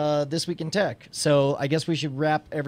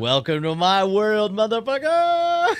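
mock taunt; 6 semitones higher, slower than usual. Mock greeting to departing host.

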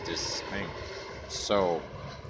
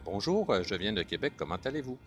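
Québécois French accent; faster than usual. Shown as a demonstration. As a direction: drop into natural Québécois. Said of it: "Puis, in Québec".

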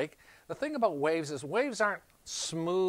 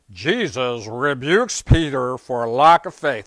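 mock sermon title; 13 dB louder, slower than usual. Mocking a typical sermon title.

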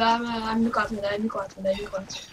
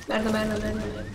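muttered swearing, fading softer; cursing under breath; 3 semitones lower, 6 dB quieter than usual. Real, not acted. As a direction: swear quietly to yourself. Frustrated after losing the rift. (xingando baixinho)

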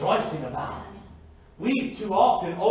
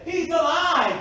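high, loud imitation of joyful disciples; exultant quoted exclamation, pitch leaping up; rejoicing cry; 9 semitones higher, 5 dB louder than usual. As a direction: cry it out like astonished good news. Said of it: "rejoicing to say".

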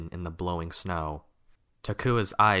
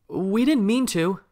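much higher, loud call; calling after someone; 14 semitones higher, 7 dB louder than usual. A narrator's reading. Takua calls after Kopaka through the snow, loudly. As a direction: call out to someone striding away. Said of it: "Takua called after Kopaka".

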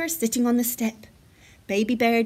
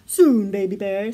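lower, louder dad bear voice; Father Bear; 4 semitones lower, 7 dB louder than usual. A narrator's reading. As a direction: answer in a big, low bear voice. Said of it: "said Father Bear".

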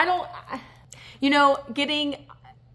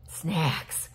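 "Snacks." whispered, lower and softer, falling; 6 semitones lower, 4 dB quieter than usual. Real, not acted. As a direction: breathe "Snacks." as a secret aside. Repeats word to herself.

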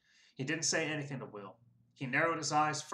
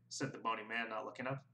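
usual speaking voice

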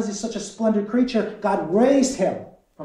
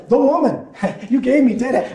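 blaming; 7 semitones higher, 6 dB louder than usual. Acted. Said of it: "Here comes the blame".

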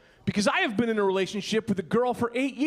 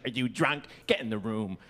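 put-on lower, quieter nagging girlfriend voice; a hint of a tired girlfriend scolding; 12 semitones lower, 4 dB quieter than usual. Girlfriend scolds him for coming home drunk, lightly voiced. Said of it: "my girlfriend's like".

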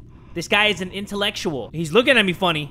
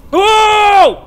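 loud, high held "oh"; real jump-scare holler; startled yell; 12 semitones higher, 13 dB louder than usual. Real, not acted. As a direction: yell out in sudden fright. Startled by the horror game.

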